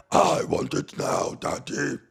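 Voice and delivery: a hint of a Cookie Monster voice, breathy and slow; lightly voiced Cookie Monster. Comic mocks the pundit's voice, a touch of Cookie Monster. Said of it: "he sounds like Cookie Monster".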